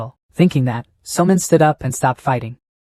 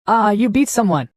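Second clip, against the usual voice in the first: mild shout, raised in pitch but not volume; higher-pitched, understated cry of alarm; 7 semitones higher than usual. A narrator's reading. Female servants see someone struck, mild high shout. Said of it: "quickly shouted".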